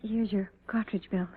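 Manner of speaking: whispered, soft and sad; hushed whisper. Acted. Wife to her departing husband, whispered. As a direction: barely voice it as you hand it over. Wife handing belt, fearful hush.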